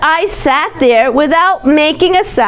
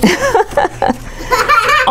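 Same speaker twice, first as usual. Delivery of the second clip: delighted laugh; 11 semitones higher than usual. Acted. Happy ending, reading to child.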